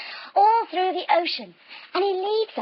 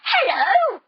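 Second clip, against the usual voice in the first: big smiling hello; 8 semitones higher, 4 dB louder than usual. Pretend. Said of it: "to the shark with a big smile".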